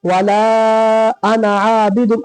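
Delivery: वला अना आबिदुन with अना ending short; slow recitation, long laa in वला, brief "ana"; correct recitation. Shown as a demonstration. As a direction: hold वला, then say अना without stretching. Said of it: "इसको कैसे पढ़ेंगे".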